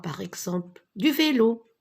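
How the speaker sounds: usual speaking voice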